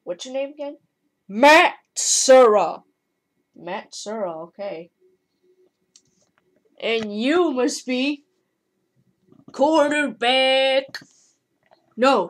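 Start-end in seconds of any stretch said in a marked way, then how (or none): 1.3-2.8 booming, drawn-out self-introduction; proud slow shout of the name; loud slow name. Pretend. Character says his own name. 9.5-11.0 slow, higher silly character voice; lightly voiced toy figure; put-on figure voice.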